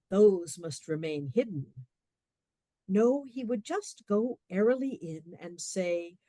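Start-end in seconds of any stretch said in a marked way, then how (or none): none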